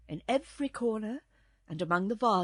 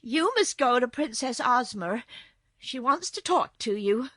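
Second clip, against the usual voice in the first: lightly voiced, louder girl; insistent little girl; 5 semitones higher, 8 dB louder than usual. A narrator's reading. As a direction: tell the kitten firmly, a little higher.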